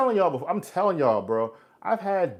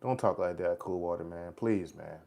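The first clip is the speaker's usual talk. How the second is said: low, soft, subdued pleading; hushed plea; 6 semitones lower, 9 dB quieter than usual. Real, not acted. Streamer begging a despairing viewer not to talk like that, hushed plea. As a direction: gently beg them, keeping the voice down.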